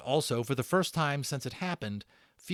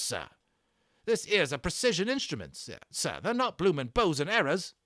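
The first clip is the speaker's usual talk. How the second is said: put-on high, loud old soldier griping about the damage; a rattled army corporal complaining to his officer; British army corporal; 7 semitones higher, 5 dB louder than usual. Corporal grumbling to young Omri about ruined weapons, loud and indignant.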